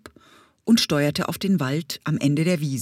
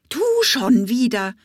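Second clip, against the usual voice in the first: higher, louder annoyed scolding bumblebee; 6 semitones higher, 4 dB louder than usual. Acted. Bumblebee scolding the parrot, exasperated. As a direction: tell off the pest who came back. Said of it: "schimpfte Hummelbrumm den Papagei".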